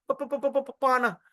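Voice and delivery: rapid string of "pa" before "pana"; drunken stammer. Acted. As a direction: get badly stuck on the start of "pana". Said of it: "you can't even say the word pana".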